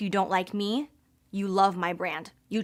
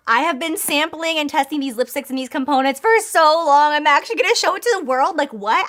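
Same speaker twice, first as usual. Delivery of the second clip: higher, louder, bubbling over; excited disbelief; giddy excitement; 6 semitones higher, 6 dB louder than usual. Real, not acted. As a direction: gush like you can't contain it. Overwhelmed showing the box.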